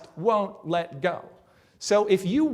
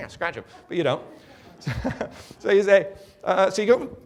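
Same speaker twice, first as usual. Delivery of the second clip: normal talking voice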